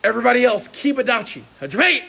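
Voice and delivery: loud calls to the whole class; shouted commands. Real, not acted. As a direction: command the room to start. Coach commands everyone else.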